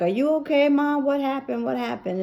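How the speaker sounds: high, urgent worried questions; alarmed. Retold or imitated. Daughter asking fallen mom what happened, alarmed. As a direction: press her for an answer.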